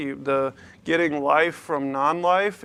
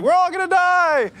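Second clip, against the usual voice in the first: put-on much higher, louder, delighted child voice; gleeful kid squeal; 7 dB louder than usual. Little son cheering at dad's warning, high gleeful squeal. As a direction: shout it like it's great fun. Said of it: "puts a smile on his face".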